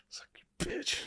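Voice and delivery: very soft, voiceless aside; whispered insult. Retold or imitated. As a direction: whisper it under your breath. Muttered aside after losing argument.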